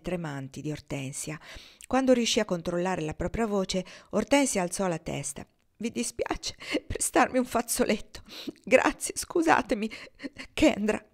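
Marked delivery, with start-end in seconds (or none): 5.8-11.0 higher, teary, with the thanks whispered; tearful. Acted. Ortensia after sobbing, asking Kendra for a handkerchief, tearful. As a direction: still fighting back tears. Just after crying into pillow.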